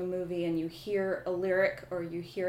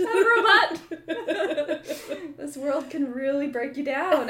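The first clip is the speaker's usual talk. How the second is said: laughing; 8 semitones higher, 7 dB louder, slower than usual. Real, not acted. Laughing while talking.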